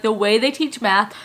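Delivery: ordinary talk, nothing marked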